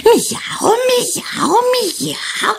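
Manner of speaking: high cross cat miaowing; angry meows. Acted. The angry cat miaows at Mariska. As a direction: yowl like an offended cat. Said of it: "haragosan karmizsálta".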